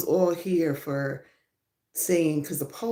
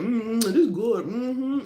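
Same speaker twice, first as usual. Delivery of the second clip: pleased mm-hmm over fries; savoring the food; 4 semitones higher than usual. Pretend. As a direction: hum like the food tastes great. Acting out enjoying fries.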